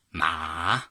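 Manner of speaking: ma dipping low then rising; third tone ma. Shown as a demonstration. Teacher demonstrating Mandarin third tone on ma.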